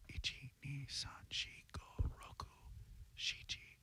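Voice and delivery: hushed, breathy whisper, each number a secret; whispered counting. Pretend. Radio host whispers the phone number to Japanese listeners, whispering.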